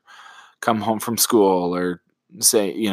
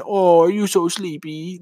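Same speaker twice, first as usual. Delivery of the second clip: put-on patronizing baby talk; 8 semitones higher than usual. Shown as a demonstration. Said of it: "that wouldn't help either".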